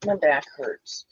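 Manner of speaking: ordinary delivery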